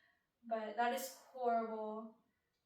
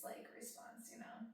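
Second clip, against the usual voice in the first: whisper; 11 dB quieter than usual. Real, not acted. Aside whispered to viewers.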